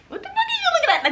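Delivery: very high, fast squeal with laughter; shrieky pretend challenge, laughing and rushed; mock confrontation. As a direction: blurt it out in a hammed-up squeaky voice. Imagined confrontation, repeated much higher.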